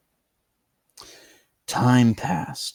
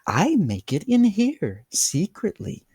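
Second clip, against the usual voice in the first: higher, confiding host; sly host; 9 semitones higher than usual. A narrator's reading. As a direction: lean in and share a secret.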